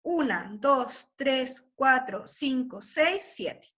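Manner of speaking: conteo lento, sílaba por sílaba; contando sílabas. Shown as a demonstration. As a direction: contar despacio cada sílaba. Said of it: "cuántas sílabas métricas". (contando sílabas despacio)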